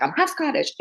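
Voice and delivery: put-on high, insistent little-girl retort; stubborn repeat. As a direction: fire it back like a stubborn kid. Arguing with grandma as a kid.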